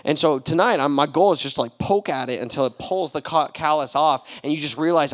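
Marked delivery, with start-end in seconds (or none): none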